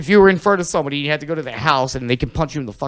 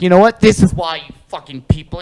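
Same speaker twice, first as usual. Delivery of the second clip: loud angry defensive rant; 4 semitones higher, 8 dB louder than usual. Retold or imitated. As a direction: snap back defensively, loud.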